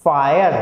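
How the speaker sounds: "फायर" said clearly, final E silent; pronunciation demo. Shown as a demonstration. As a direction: say "फायर" as a word on its own, ending on R. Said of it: "ठीक से पढ़ते हैं". ("फायर" साफ़ उच्चारण, आख़िरी ई चुप)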